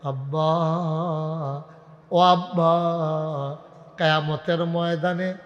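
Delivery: slow, drawn-out melodic lament; tearful son. Acted. Son returning to tell his father of the crown, tearful chanted lament. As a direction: stretch the vowels into a wavering chant. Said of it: "চোখের পানি ছেড়ে দিয়ে কয়".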